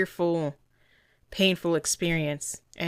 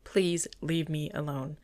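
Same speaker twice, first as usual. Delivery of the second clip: voicing the pleading dog; 3 dB quieter, slower than usual. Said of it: "she's saying".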